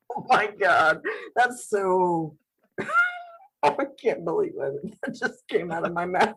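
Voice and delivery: usual speaking voice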